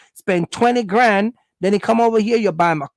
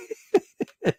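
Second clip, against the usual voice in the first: giggly high laugh; 8 semitones higher, 5 dB quieter than usual. Retold or imitated. Woman charmed by Jamaican man.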